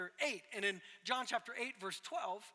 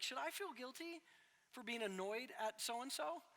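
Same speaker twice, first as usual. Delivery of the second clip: questioning wife; 8 dB quieter than usual. Retold or imitated. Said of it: "she said it as a question".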